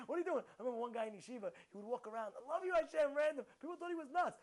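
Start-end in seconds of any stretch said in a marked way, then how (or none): none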